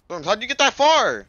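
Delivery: high, very loud shout; real shouted question, pitch shooting up; incredulous yell. Real, not acted. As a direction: yell it at a teammate in disbelief. Yells at teammate in disbelief.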